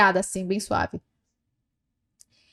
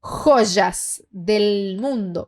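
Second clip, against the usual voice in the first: very slow dictation; 3 semitones lower, much slower than usual. Shown as a demonstration.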